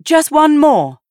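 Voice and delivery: high, loud call; calling back. A narrator's reading. Maddie calling back to her sister, high and loud. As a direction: call it across the garden. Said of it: "Maddie called back".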